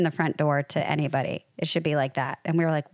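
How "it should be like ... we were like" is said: ordinary talk, nothing marked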